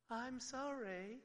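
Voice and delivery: slow, drawn-out sorry with a rise; insincere apology. Retold or imitated. Child to parent, a slow, insincere apology. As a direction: draw it out, plainly not meaning it. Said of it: "and you don't mean it".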